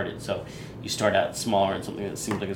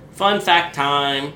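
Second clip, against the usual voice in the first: announcer voice; 4 semitones higher, 6 dB louder, much slower than usual. Acted. Announcing segment, stretched.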